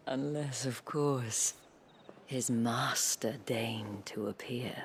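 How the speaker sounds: drawn-out breathy hint; sly slow whisper. Acted. Isabel hinting at a plan to Clive, slow and sly. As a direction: let the idea sink in slowly.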